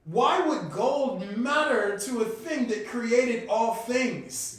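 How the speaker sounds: loud, high rhetorical questioning; incredulous rant. Real, not acted. Man questioning why God values gold, incredulous rant.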